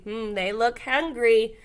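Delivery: usual speaking voice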